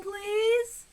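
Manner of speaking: please drawn out high and sugary; fake-kind please called downstairs; sweet phony please. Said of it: "in a sweet, phony voice".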